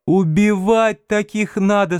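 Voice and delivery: high-pitched imitation of an indignant woman bystander; woman's voice. A narrator's reading. Female bystander commenting on the driver, high woman's voice. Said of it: "сообщил другой, женский".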